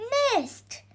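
high-pitched exclamation, not full volume; crowd's yell. A narrator's reading. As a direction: call it out like a spectator. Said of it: "yelled the crowd".

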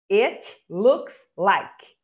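it looks like said slowly and clearly; slow model phrase. Shown as a demonstration. Teacher models "it looks like" for students, slowly. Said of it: "Isso aqui significa parece".

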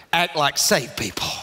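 normal talking voice

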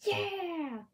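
potato cheering. Retold or imitated. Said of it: "and he's saying".

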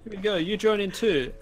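mock coach.

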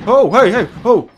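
loud, high bursts of alarm; startled cry. Real, not acted. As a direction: yelp out in sudden alarm. Startled while playing horror game.

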